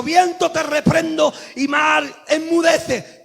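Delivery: loud, slow command to the storm; commanding rebuke. Acted. Peter rebuking the wind and sea, loud. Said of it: "fuera dicho".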